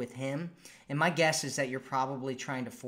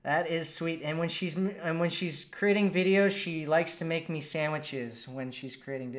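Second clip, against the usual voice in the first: ordinary talk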